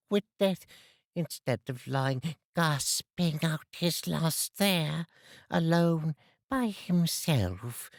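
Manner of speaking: put-on high, bitter old woman; spiteful charwoman. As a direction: sneer at the dead man. Charwoman rants.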